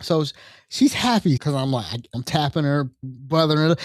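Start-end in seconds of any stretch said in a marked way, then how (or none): none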